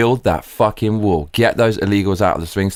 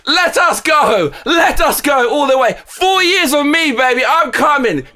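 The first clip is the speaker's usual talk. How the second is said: ecstatic hype; 14 semitones higher, slower than usual. Real, not acted. To his viewers, celebrating the Trump win, ecstatic high shouting. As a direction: yell it out like your team just won the cup.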